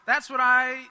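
normal talking voice

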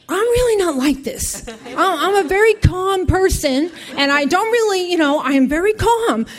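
high, loud protest of calm; frantic insistence. Retold or imitated. Overjoyed woman assuring agency caller, frantic insistence. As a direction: insist on calm while completely worked up.

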